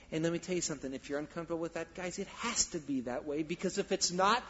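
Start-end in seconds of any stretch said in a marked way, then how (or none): none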